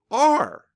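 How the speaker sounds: are punched high and loud; stress on are. Retold or imitated. Quoting a Twitter reply, stress on are. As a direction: hit the word "are" hard and high. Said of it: "it's also are. Not will be".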